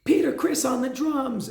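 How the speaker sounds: high, called-out band introduction; imitated stage announcement. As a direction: announce the drummer to a concert crowd. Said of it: "when the drum solo ends".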